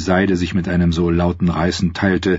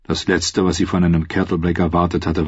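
usual speaking voice

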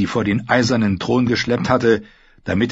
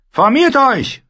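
high, loud battlefield order; rally the troops; shouted command; 6 dB louder, slower than usual. Acted. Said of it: "rief er". (gebrüllter Befehl)

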